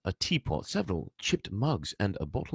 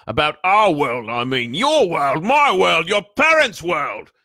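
loud, high, exasperated shouting; exploding giant; 11 semitones higher, 12 dB louder, slower than usual. A narrator's reading. Giant tries to explain to the boy, exasperated shouting. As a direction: shout each phrase with mounting force. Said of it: "looked as if he was about to explode".

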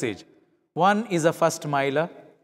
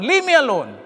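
louder, cutting dismissal; angry brush-off; 6 semitones higher, 6 dB louder than usual. Acted. Upset Mohan snapping at his wife after coming home early, angry. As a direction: shut your wife down. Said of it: "in an angry upset".

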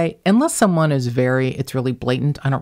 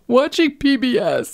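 mock refined; 10 semitones higher than usual. Mock-refined description of mom's habit.

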